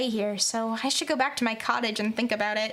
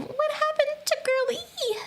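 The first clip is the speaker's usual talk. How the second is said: high worried voice; 13 semitones higher than usual. Acted. Voicing worried girl.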